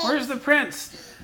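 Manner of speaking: storyteller voice. Pretend. Parent narrates puppet show dramatically.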